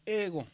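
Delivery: "ego" con la E marcada y GO bajando; acento en la primera vocal. Shown as a demonstration. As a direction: alarga la E de "ego", deja caer GO. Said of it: "hay que subir el anterior". ("ego" acentuado en la primera sílaba)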